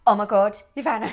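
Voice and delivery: loud imitated gasp of alarm; excited exclaim. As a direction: burst out as the sister did on the phone. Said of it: "she went".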